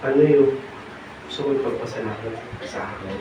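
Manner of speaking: low, very soft voice of the Lord; gentle hushed question. Retold or imitated. The Lord asks the preacher this morning, gently hushed. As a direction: let the question sink almost to nothing.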